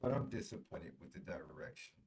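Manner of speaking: muffled, garbled bad connection; underwater audio. Real, not acted. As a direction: sounds like talking underwater. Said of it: "It sound like your audio is underwater".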